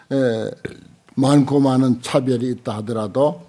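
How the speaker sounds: ordinary delivery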